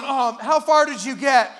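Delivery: normal talking voice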